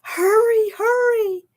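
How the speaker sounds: high, loud hurry; high urgent call. Acted. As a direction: rush them along in alarm. Annie urging the baby dinosaur.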